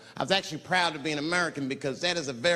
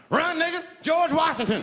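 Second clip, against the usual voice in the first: high, loud urgent yell; panicked warning shout; 6 dB louder than usual. Retold or imitated. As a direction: scream it to warn him.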